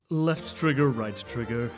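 normal talking voice

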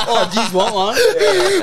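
high, slow, laughing offer; mock offering chips. Guest offering chips in the corner, high and slow with a laugh. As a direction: dangle the question sweetly, laughing.

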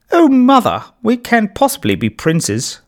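high, posh little prince voice; a boy putting on a grand royal accent, laughing; posh princely accent. A narrator's reading. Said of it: "laughed Toby in a posh princely accent".

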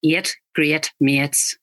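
slow Northern Irish, eight as eet. Shown as a demonstration. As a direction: model the Northern Irish vowels slowly. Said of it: "Northern Irish".

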